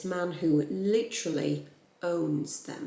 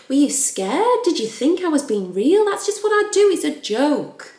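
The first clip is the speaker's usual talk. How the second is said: loud, high mock-innocent voice; exaggerated, swooping "only kidding" delivery; hammed-up joking protest; 8 semitones higher, 9 dB louder than usual. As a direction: act out laughing it off as a joke, big and high-pitched. Acting out John brushing it off.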